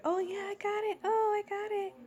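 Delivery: very high singsong mimicry; mock a show-off rushing to take over; eager coworker, mocked. Coworker grabbing the task, high mocking imitation.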